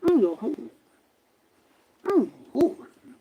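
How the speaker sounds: indistinct, smothered imitation; muffled ghost voice. Imitating the ghost that said it was her husband, muffled. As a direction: blur the words as if heard through a wall. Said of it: "It sound muffled".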